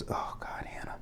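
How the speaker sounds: very soft, nearly whispered groan of dismay; exasperated mutter. Real, not acted. Viewer despairing of Hannah to herself, exasperated mutter. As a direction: mutter it under your breath, fed up.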